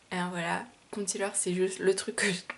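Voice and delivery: normal talking voice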